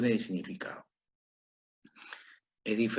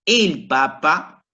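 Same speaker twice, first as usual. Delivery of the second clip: "el Papa" slow and louder, weight on "el"; stress on EL Papa; 4 semitones higher, 6 dB louder, much slower than usual. Shown as a demonstration. Teacher presents the masculine word to the class, slow emphatic "el Papa". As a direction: set out "el Papa" slowly and firmly. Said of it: "cuando nosotros decimos".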